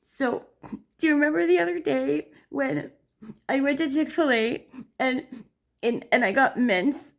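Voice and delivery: mock tearful daughter; imitating teary daughter. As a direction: retell the mint drama through tears.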